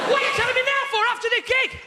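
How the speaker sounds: a mock-outraged shout at the tour manager; exasperated outburst. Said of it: "He went / I said".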